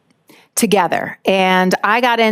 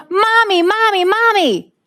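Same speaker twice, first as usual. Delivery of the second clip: put-on high-pitched child calling out for mommy; a small boy pointing and shouting across the pool; toddler yelling; 11 semitones higher than usual. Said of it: "he yells".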